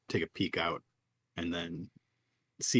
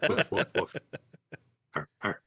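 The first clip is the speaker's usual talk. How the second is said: dog barking; 3 semitones higher, 4 dB louder than usual. Acted. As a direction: answer as the dog in woofs and arrs. Player voices the dog character.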